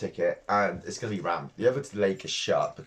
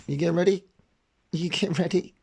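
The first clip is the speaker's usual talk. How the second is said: talking to the dog; 7 semitones higher, 3 dB louder than usual. Real, not acted.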